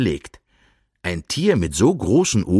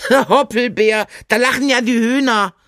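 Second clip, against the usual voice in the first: much higher, laughing-tinged hare voice; amused hare; 13 semitones higher, 3 dB louder than usual. A narrator's reading. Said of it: "hält sich den Bauch vor Lachen".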